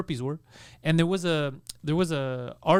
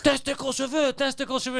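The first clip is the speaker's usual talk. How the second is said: high, mangled French shouted at the clerks; mock broken French yell; 7 semitones higher than usual. Friend yelling at clinic staff, in mock broken French. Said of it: "and he's screaming at them".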